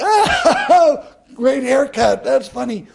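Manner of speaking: high, loud mocking laugh-line; laughing mockery. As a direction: siblings cracking up at him.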